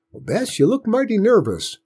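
put-on teasing old farmer at supper; old grandpa. A narrator's reading. Grandpa needling Aunt Bess at supper, old country voice.

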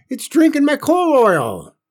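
high, loud frantic scream; hysterical scream. A narrator's reading. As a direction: shriek the news in panic. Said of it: "Aunt Bess screamed".

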